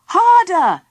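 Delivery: high, loud urging cry; shout. A narrator's reading. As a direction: shout to spur them on. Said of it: "shouted Philip".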